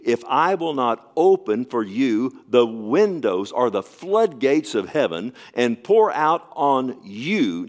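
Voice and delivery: slow, loud scripture reading; preaching scripture. Acted. Pastor reading Malachi to the church, slow and loud. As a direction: proclaim each word slowly.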